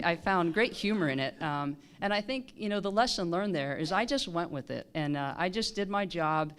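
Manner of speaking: ordinary delivery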